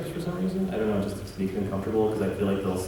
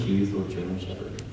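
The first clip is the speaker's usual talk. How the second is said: ordinary delivery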